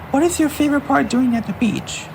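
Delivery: high, curious question; curious sister. Acted. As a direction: ask about the best part of the day. Arcee asks favourite part.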